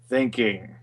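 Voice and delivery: ordinary talk, nothing marked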